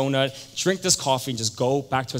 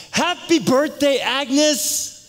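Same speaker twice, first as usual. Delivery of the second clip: huge, high, drawn-out party holler; celebratory shout; 13 semitones higher, 7 dB louder, much slower than usual. Retold or imitated. Tony greets Agnes at her party, shouting joyfully. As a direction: shout it out to the whole room, slow and high.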